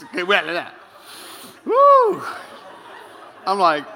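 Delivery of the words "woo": loud, high "woo"; high whoop. Acted. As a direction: let out a rattled whoop. Reacting to wife's outburst.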